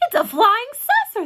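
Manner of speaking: high, loud crowd cry; excited shout. A narrator's reading. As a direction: shout it out in alarmed amazement. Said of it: "they shouted".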